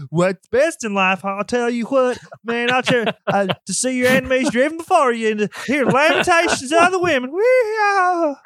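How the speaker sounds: high, twangy country-yokel Conan; yokel farm-boy accent. Retold or imitated. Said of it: "yokel kind of farm boy accent".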